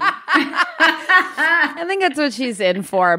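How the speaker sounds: slow, laughing and drawn out. Real, not acted. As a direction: laugh through the words. Laughing reply.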